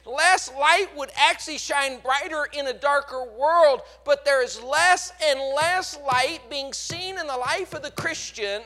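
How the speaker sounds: loud, high preaching voice; full-force preaching. Acted. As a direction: preach it out to the back row.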